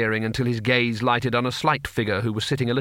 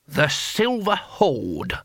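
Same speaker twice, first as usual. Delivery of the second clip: very slow, higher, drawn-out announcement; touch of pride; 5 semitones higher, much slower than usual. A narrator's reading. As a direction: savour the grand name slowly. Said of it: "with a touch of pride".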